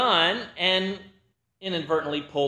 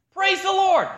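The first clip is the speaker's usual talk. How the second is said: eager cheer; 11 semitones higher, 5 dB louder than usual. Retold or imitated. Rider cheers as horse trots.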